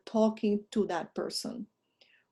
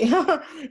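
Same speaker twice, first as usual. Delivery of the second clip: short, higher laugh at own slip; embarrassed laugh; 6 semitones higher, 5 dB louder than usual. Real, not acted. Teacher catching own mistake mid-explanation, embarrassed laugh. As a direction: burst out in a brief, loud laugh. Sheepish laugh after misspeaking.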